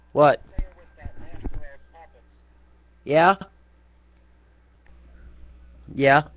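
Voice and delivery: a lightly voiced mocking streamer, short and defiant; mild mock impression of a rival streamer; Make Electric impression. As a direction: toss off curt challenges in someone else's voice. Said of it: "Let me do a Make Electric impersonation".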